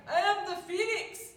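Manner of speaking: hammed-up Scottish boast; Scottish accent. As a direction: announce it like a Scottish hero. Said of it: "I'm not very good at the Scottish accent".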